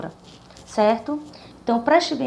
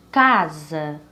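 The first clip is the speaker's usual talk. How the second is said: "Casa" slowly, with a long strong "Ca"; stress on first syllable; 7 semitones lower than usual. Shown as a demonstration. Teacher repeating a word for the class, first syllable stressed in "Casa". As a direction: stretch the "Ca" in "Casa", let the ending drop. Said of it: "a sílaba mais forte seria".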